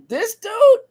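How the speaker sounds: high, loud scoffing disbelief; a sharp, incredulous jab at the preacher. Retold or imitated. Listener doubting the preacher, high loud disbelief. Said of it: "you would say".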